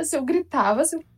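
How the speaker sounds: ordinary delivery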